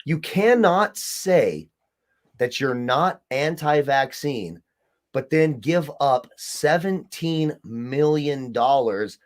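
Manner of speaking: very slow, each word hammered; slow emphasis. Real, not acted. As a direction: spell it out word by word to make the point. Emphatic argument point by point.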